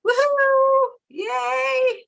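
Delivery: high, excited whoop; excited cheer. Real, not acted. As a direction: cheer it out with glee. Cheering about brewery plans.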